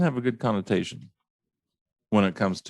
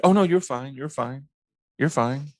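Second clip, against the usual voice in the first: higher, quick Oh, no, you're fine; mock breezy brush-off; 5 semitones higher than usual. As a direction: wave it off fast and airy. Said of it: "people say it so fast".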